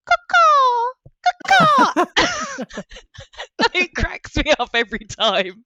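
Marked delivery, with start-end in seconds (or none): 0.1-2.0 high squawking caw-caw; bird call caw. Retold or imitated. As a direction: squawk it like a crow, much higher. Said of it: "a grown adult going".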